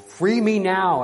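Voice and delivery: loud, high, drawn-out command; frantic demand. Acted. Frenzied knight demanding freedom, loud high cry. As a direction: call it out slowly and forcefully.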